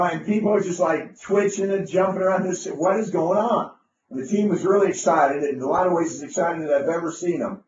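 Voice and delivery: normal talking voice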